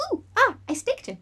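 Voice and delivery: lightly voiced Willow; a hint of a squeaky Willow. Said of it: "she's like".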